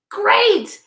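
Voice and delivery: high, bright encouraging exclamation; cheerful praise. Real, not acted. Teacher praising kids who guessed wrong, cheerful exclamation. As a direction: celebrate the try.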